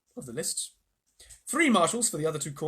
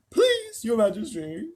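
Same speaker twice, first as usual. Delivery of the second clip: high, loud bear plea; pleading bear; 8 semitones higher, 6 dB louder, slower than usual. A narrator's reading. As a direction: beg like a big dopey bear. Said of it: "said the bear".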